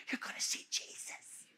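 hushed, voiceless whisper; whispered assurance. Acted. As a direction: whisper it as a comforting secret. Hushed comfort before death.

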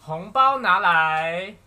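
loud, slow playful demand; cheerful new year wishes. Acted. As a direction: call out for the red envelope.

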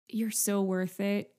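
ordinary talk

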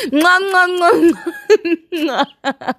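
laughing kisses blown loud; smacking kisses with laughter; between kisses. Real, not acted.

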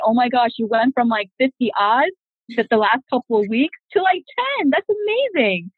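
high, bright burst of cheering praise, slowing on the last words; excited praise. Retold or imitated. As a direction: gush over a friend's progress. Cheering a friend at Toastmasters.

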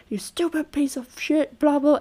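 a light mock sneer from bullying children; bullying victims. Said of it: "It's like they're bullying him".

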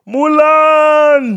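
high, loud excited call; excited announcement. Acted. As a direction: announce it with a burst. Announcing song from Mulan.

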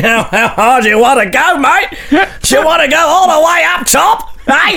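high, shouty silly character voice; hammed-up high-pitched comic challenge; dialled-up nonsense. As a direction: go over the top like a manic lout.

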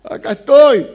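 high, very loud triumphant shout; shouted answer. Acted. Daniel answers the king from the den, loud shout. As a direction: shout up from the pit.

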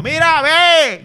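sudden high yell across the party; shouting at the clown. Retold or imitated. Uncle shouting at the clown at a kids' birthday, a loud high yell. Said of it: "siempre le gritaba al payaso".

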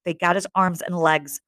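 ordinary delivery

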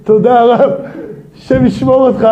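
student thanking the rabbi with relieved laughter; laughing thanks. Acted. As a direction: gush thanks through a laugh.

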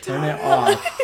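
hammed-up shouted order; retold scream. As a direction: re-enact a screamed demand, half laughing. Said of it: "I started screaming".

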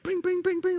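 rapid, high cartoon whacking sounds; high-pitched bing noises. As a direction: imitate a game hammer, high and quick. Acts out whacking moles.

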